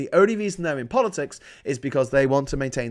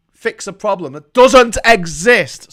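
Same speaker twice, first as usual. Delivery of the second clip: loud outraged shout, voice cracking; 5 semitones higher, 12 dB louder, slower than usual. Real, not acted. Ranting vlogger shouts at the Tories, loud. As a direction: yell it at the government. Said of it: "Sorry, I can't shout".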